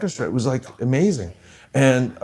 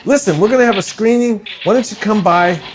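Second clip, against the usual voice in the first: higher-pitched imitated Bronx accent; 8 semitones higher than usual. Spokesman inviting a composer to a screening, Bronx accent. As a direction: retell the invitation in his Bronx voice. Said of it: "Bronx accent".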